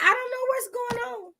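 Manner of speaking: high-pitched, whimpering bewilderment; hammed-up confused whine; mock panic. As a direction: play it like a lost, frightened reader. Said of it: "I was like".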